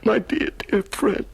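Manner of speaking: high, tearful, trembling with emotion; crying. Acted. Prisoner weeping tenderly over Mother Mouse, high and tearful. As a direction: weep over the little mouse with love. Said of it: "Do you know I'm crying again?".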